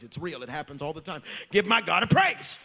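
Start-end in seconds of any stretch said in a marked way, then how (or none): 1.5-2.6 louder, higher rallying call; loud call for praise. Real, not acted. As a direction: lift the crowd to shout praise.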